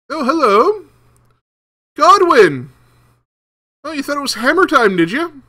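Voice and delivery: gleefully busting a sneaky prisoner; gotcha guard voice. Acted.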